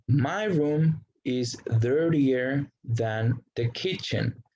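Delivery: English sentence read slowly, with a lift on kitchen; slow example sentence. Shown as a demonstration. Teacher reading an English example to Spanish-speaking students, slow and careful. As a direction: model the sentence slowly for the class.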